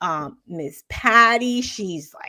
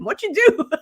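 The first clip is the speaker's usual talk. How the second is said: surprised question; 13 semitones higher, 7 dB louder than usual. Retold or imitated. Asking grandma what she did.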